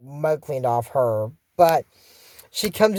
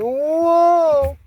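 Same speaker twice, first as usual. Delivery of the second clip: startled whoa; 9 semitones higher, 4 dB louder than usual. Acted. Slipping into the bog.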